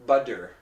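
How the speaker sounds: "butter" said as "budder", full D. Shown as a demonstration. Teacher showing the mistaken version, "butter" with D. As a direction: put a firm D in "butter". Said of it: "If it was a D".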